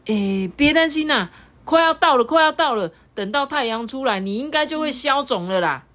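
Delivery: high, comforting; reassuring. Acted. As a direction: soothe the frightened friend. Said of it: "他安慰天米".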